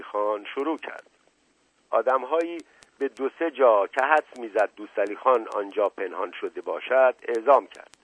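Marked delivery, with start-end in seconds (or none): none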